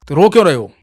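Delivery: angry scolding. Acted. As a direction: scold the fussy child. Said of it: "गुस्सा तो थे ही वो".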